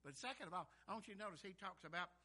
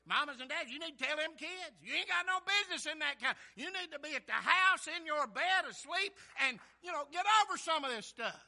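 loud, raised preaching voice; full-force exhortation; 8 semitones higher, 14 dB louder than usual. Real, not acted. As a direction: scold and urge from the pulpit.

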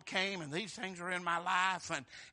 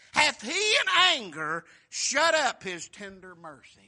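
loud, slow, raised lament; preaching reading; 2 semitones higher, 11 dB louder, slower than usual. Acted. Preacher booming the psalmist's despairing questions to the church. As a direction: cry the questions out over the congregation. Lament read at full force.

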